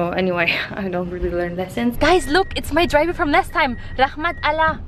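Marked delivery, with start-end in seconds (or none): 2.0-4.8 high, delighted look; excited greeting. Real, not acted. Spotting her driver from last time, excited and high. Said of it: "spotting her driver again".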